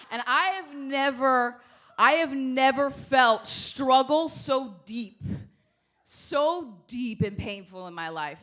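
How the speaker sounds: loud, slow melodramatic despair; melodramatic lament. Acted. Mock dramatic suffering.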